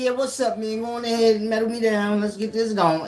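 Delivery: high, loud cocky retelling of his own bravado; hammed-up high loud swagger. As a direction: strut through the detector. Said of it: "I say".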